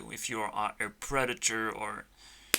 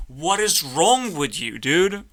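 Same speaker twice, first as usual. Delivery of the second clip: exasperated shout; 4 semitones higher, 8 dB louder than usual. Acted. Berating the clown duck.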